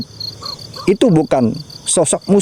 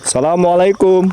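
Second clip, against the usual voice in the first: salam khidmat; 6 semitones higher than usual. Acted. Memberi salam di makam.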